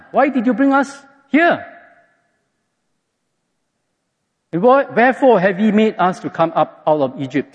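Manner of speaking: high, whiny accusing voice, half laughing; the people blaming Moses for bringing them out; complaining Israelites. Retold or imitated. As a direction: whine at Moses like a grumbling crowd.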